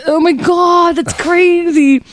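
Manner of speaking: very high, loud, drawn-out marveling; hammed-up high-pitched astonishment; stoned amazement. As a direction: squeal it slowly in mock wonder. Acting out marveling at own arm.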